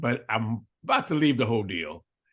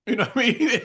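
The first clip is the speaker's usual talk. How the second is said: laughing; 7 semitones higher, 3 dB louder, faster than usual. Real, not acted. Laughing mid-story.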